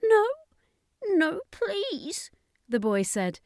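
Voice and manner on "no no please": put-on high boy's voice, pleading and breaking; a child falling apart in grief. A narrator's reading. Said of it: "as he broke down".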